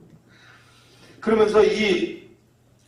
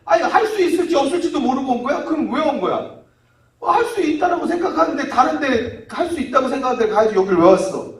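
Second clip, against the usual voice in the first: loud, raised-pitch reproach; exasperated scolding; 6 semitones higher, 4 dB louder than usual. Acted. Jesus scolding a father who came doubting, exasperated. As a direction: scold in indignant questions.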